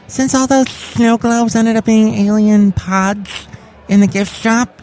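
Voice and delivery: [name] voice. Acted. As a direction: stretch it out high as the spooked chef. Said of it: "It's [name]".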